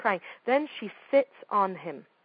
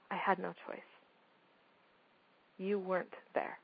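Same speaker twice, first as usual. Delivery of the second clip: much softer, calm and measured; quiet, steady reply to an angry mother; 12 dB quieter than usual. Retold or imitated. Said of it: "I looked at her very calmly".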